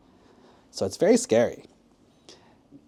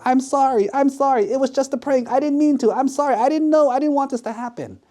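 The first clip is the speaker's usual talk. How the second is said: high-pitched pleading, tumbling out; desperate apology; 12 semitones higher than usual. Acted. Prankster apologising to everyone yelling at him, desperately pleading. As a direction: beg forgiveness in a frantic high voice.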